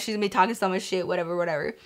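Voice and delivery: dismissive mutter. Retold or imitated. Bracing herself for teasing, retold thought.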